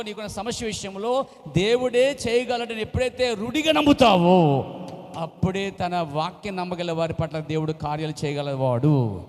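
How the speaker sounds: loud, slow fervent preaching; preaching at full force. Acted. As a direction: drive it home to the congregation. Preaching climax. (ఉత్సాహంగా బోధించే ప్రసంగం)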